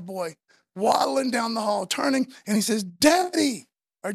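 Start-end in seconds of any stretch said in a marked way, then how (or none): 2.8-3.7 put-on loud, high call of a little boy to his dad; joyful shout, imitating a small child; excited toddler. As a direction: imitate a toddler overjoyed to see a parent. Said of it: "I'm excited".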